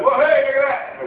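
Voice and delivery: spring it on him to startle; mock showy exclamation. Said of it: "he's going".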